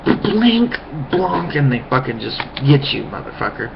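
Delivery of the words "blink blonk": goofy blink blonk. Retold or imitated. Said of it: "that's where you're like".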